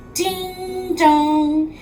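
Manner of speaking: plain reading voice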